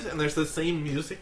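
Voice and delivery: normal talking voice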